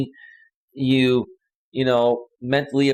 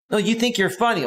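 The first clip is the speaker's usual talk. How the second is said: put-on higher, irritated girlfriend voice; lightly voiced peeved girlfriend; annoyed girlfriend; 8 semitones higher than usual. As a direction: brush him off as she would. Voicing the angry girlfriend.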